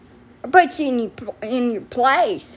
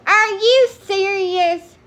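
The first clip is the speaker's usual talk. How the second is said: high, slow, drawn-out disbelief; incredulous; 7 semitones higher, slower than usual. Real, not acted. Streamer reacting to a rude comment in her live chat, high and drawn out in disbelief. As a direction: stretch it out, can't believe it.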